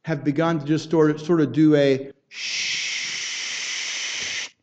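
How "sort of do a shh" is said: voiceless static hiss. Shown as a demonstration. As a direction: breathe out a hush of static noise.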